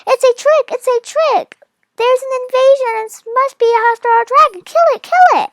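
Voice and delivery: high, loud panicked shouting; animals yelling. Acted. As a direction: scream it like a frightened mob of animals. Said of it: "and all yelling".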